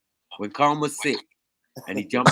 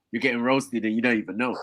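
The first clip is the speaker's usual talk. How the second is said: normal talking voice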